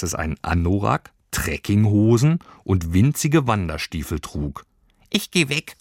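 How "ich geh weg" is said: high creature voice, proudly announcing; announcing little creature. Acted. Little creature announcing its departure to Lilli, high and proud. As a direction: announce it like a proud tiny traveller. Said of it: "verkündete das Tierchen".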